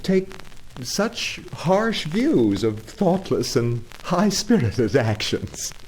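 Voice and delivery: high, stammering excuse; embarrassed Toad. Acted. Toad excusing his car theft to the engine driver, flustered. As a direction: fumble for justification.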